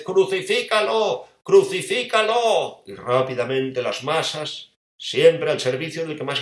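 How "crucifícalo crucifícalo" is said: high, slow drawn-out shout; shouting crucify. Acted. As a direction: hurl the cry at the crowd. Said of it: "empieza a gritar otra vez".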